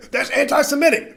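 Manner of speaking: high-pitched mock accusation; mock outrage. Repeats the mocked accusers' cry.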